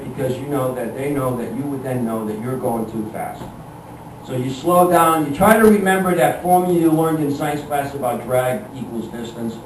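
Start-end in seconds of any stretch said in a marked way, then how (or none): none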